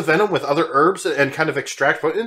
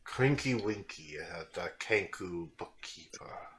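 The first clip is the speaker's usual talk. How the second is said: lower, slow, measured voice of a polite guest; calm formal gentleman; 8 semitones lower, 17 dB quieter, slower than usual. Acted. Valjean explaining the cat to the party, lower and unhurried. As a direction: speak like a composed scholar making excuses for the cat.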